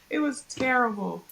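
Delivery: ordinary talk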